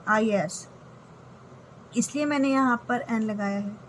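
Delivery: usual speaking voice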